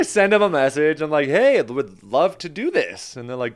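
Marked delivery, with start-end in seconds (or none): 1.3-3.2 drawn-out, higher, eager yes; louder, keen retold reply. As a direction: jump at the offer, stretching the words. Eager reply to casting post.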